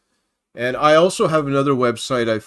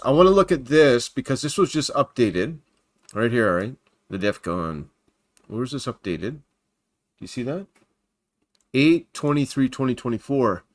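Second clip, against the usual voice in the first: ordinary delivery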